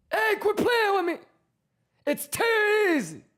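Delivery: teasing high voice. Pretend. Teasing chat about easy gains in a high squeaky voice.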